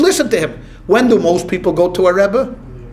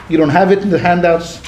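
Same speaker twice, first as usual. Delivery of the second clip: usual speaking voice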